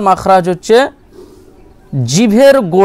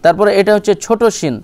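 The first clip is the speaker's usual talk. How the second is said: normal talking voice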